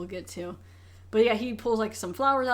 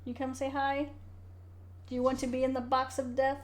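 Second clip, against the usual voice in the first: higher-pitched voice for a pet; pet talk; 4 semitones higher, 3 dB quieter than usual. Real, not acted. Podcaster asking the cat over, high pet talk. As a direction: coax the cat in a raised voice.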